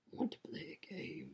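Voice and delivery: breathy whispered villain line; eerie horror villain whisper; Jigsaw voice. Retold or imitated. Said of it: "we all went jigsaw mode".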